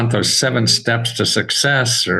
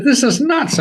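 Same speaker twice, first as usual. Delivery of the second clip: exasperated; 14 semitones higher than usual. Retold or imitated. Retold outburst to himself.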